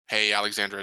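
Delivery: ordinary delivery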